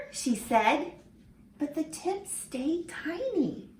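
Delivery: lively storybook reading for little ones; reading to children. Acted. Teacher reading a picture book to her class, lively and expressive. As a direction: read it like story time in class.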